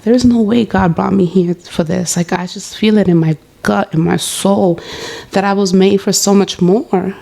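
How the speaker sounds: ordinary talk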